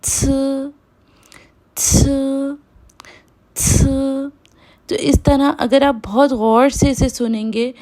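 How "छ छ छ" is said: छ with air through closed teeth; airy छ; pinyin c sound. Shown as a demonstration. Teacher repeating pinyin c for students. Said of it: "तो इस तरह से जो है वो".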